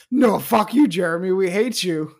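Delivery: loud shouted insult; mock angry kids. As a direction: yell it back at him, all together. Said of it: "And they're like".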